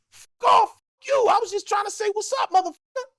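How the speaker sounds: high-pitched indignant imitation; mock outrage, pitched high; offended comeback. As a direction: fire back affronted, voice way up. Retold friend's offended comeback.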